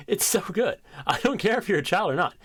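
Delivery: ordinary talk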